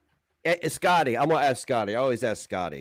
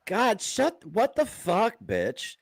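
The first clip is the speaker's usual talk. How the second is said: much higher exasperated outburst; angry outburst; 10 semitones higher, much slower than usual. Real, not acted. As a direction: snap in high-pitched disbelief.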